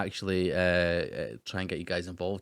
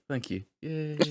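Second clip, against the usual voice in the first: usual speaking voice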